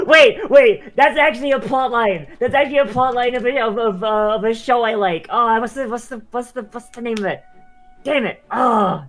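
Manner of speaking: high, loud excited rush. Real, not acted. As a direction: blurt it out excitedly. Excited recalling a show.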